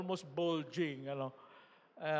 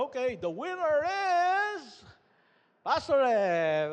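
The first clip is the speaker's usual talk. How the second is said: mock emcee announcement; 9 semitones higher, 9 dB louder than usual. Retelling how the emcee named him winner, a light announcer voice. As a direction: build it up like a party host.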